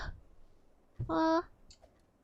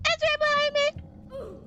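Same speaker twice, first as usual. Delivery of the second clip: high, slow panicked wail; scared horror game streamer; 10 semitones higher, slower than usual. Real, not acted. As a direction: cry out as it closes in. Monster right behind, panicked.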